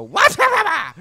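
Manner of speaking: high, loud mock scream. Screaming at breakfast with cereal in mouth, loud high yell. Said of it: "I'm screaming".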